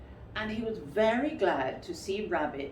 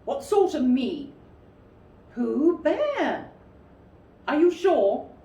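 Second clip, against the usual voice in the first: higher, louder surprised lines; surprised exchange; 8 semitones higher, 9 dB louder than usual. Acted. Rabbit and Pooh questioning each other, surprised. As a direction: sound more and more surprised. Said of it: "still more surprised".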